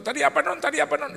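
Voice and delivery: high, loud excited asking, imitated; eager diner. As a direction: mimic an overexcited friend calling out. Teman bersemangat bertanya pesanan.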